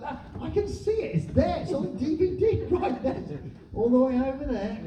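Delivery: high-pitched mock pointing-out; acted wife. Acting a wife pointing at the DVD, high-pitched. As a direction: pitch it up as if spotting it across the room.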